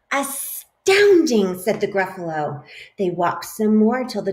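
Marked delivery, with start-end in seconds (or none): 0.1-1.6 slow, loud wonder; drawn-out amazement. Acted. Gruffalo, astonished by the mouse, slowly marvels. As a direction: stretch the word in awe.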